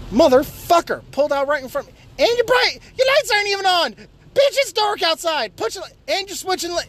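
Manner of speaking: high, angry yelling at a bad driver; furious driver shouting at a car ahead; road rage yell. Real, not acted. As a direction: yell at the driver who cut you off.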